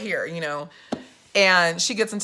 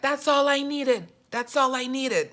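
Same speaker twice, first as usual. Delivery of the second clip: lightly voiced elderly lady; 5 semitones higher than usual. Elderly woman after meeting the candidate, lightly imitated. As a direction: lightly mimic an older woman's satisfaction. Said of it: "This lady was like".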